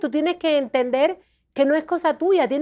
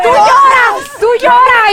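loud, high mock urging; friend's advice; 9 semitones higher, 10 dB louder, slower than usual. Imitating a friend telling her to cry, loud and high. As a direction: push it like a friend hammering advice. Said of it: "me dijo un amigo".

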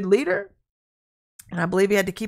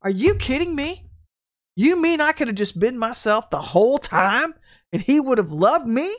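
loud, high, disbelieving questions; acted-out incredulous outburst; incredulous realization; 4 semitones higher, 5 dB louder than usual. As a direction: ask it in astonishment, voice climbing. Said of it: "I'd be thinking".

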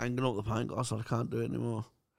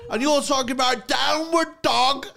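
much higher and louder, laughing as he rants; laughing outrage; 12 semitones higher, 8 dB louder than usual. Real, not acted. Hungover and offered yoga, higher, louder laughing outrage. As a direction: rant in laughing disbelief.

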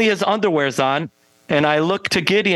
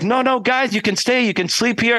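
higher-pitched mock coaxing; imitated pleading; 8 semitones higher than usual. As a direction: mimic an eager host urging guests to stay. Odd host begs band to stay.

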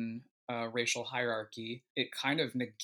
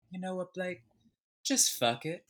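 higher-pitched Southern belle imitation, Georgian accent; Amelia's Southern Georgian accent; 7 semitones higher, 4 dB louder than usual. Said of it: "had this soft Southern Georgian accent".

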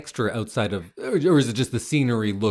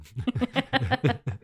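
high suppressed giggle; trying hard not to laugh; stifled giggles; 12 semitones higher, 3 dB quieter than usual. Real, not acted. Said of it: "I'm trying not to laugh into the microphone".

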